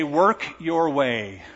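drawn out slowly, each word stretched; slow quote. Retold or imitated. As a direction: preach it slowly as religion's demand. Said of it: "religion will say".

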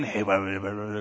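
soft, low murmuring aside; a husband murmuring to his wife; whispered chatter. Retold or imitated. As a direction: lean in and gossip under your breath. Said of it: "husband talking to wife".

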